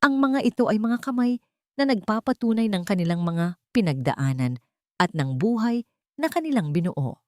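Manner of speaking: plain reading voice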